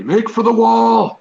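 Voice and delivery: high, loud, drawn-out shout; shouted rallying call. Acted. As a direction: call the whole group to retreat. Player's character calls out a retreat.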